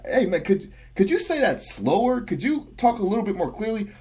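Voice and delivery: high-pitched retold plea; pleading request. Telling his mumbling best friend to talk clearer, mock pleading. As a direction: plead with him, pitch riding up. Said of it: "I always gotta tell that guy".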